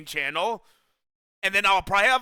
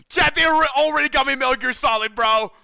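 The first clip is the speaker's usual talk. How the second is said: louder, higher, thrilled shouting; excited shout; 6 semitones higher, 6 dB louder than usual. Real, not acted. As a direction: yell it with pure joy. Gift arrives, shouting with joy.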